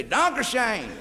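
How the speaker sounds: "Dankeschön" said high and drawn out the southern German way, laughing; southern German accent. Retold or imitated. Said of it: "Southern Germany, they say".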